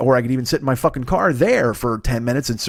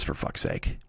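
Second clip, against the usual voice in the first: whispered aside; 12 dB quieter, slower than usual. Real, not acted. Frustrated aside about his voice.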